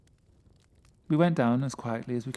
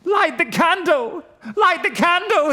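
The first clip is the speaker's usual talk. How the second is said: high, loud, breaking plea; 12 dB louder than usual. Acted. Terrified friend begging for the candle, voice breaking. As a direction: cry out so the voice cracks. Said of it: "Then his voice broke".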